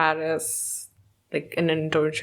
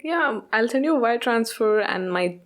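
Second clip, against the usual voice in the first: a hint of a breezy German accent; German heiress accent. Anna brushing off a creditor, lightly German-accented. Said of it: "she's like".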